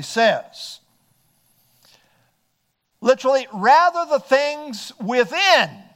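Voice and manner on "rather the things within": "within" drawn out, higher and louder; stress on within. Real, not acted. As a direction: lean slowly and loudly on "within". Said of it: "I want to emphasize that word".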